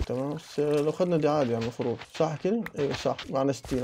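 ordinary delivery

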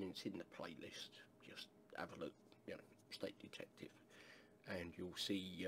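soft, unvoiced aside; whispered aside. Aside about the playlist.

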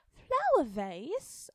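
very loud, slow, rising disbelief; girl echoing a strange name in shock; surprised. Acted. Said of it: "Alice was surprised".

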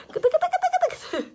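very high squeaky tickling coo, slow. Real, not acted. Mother tickling the baby. (çok ince, yavaş gıdıklama sesi)

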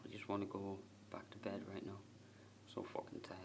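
whispered, worn out, barely voiced; tired whisper. Real, not acted. Whispering to the camera before the shout, exhausted. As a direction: breathe it out, exhausted and spent.

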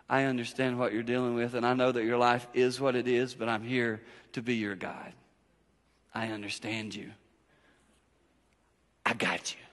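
tender voice of God. Pretend. God reassuring a weary parent, softly and tenderly. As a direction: comfort them gently as God would.

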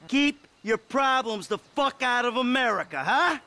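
high, emphatic telling-off; scornful demand. Acted. Lecturing captive before disposing of him.

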